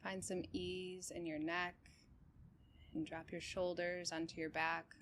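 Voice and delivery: calm, even meditation guide with long pauses; unhurried body-scan voice, settled and steady; guided meditation. Real, not acted. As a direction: guide them gently through breath and body, leaving space between phrases.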